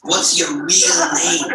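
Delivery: exasperated rabbi, stretching the final question; insistent rabbi. Acted. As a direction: press him as the Yiddish-accented rabbi. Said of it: "He goes".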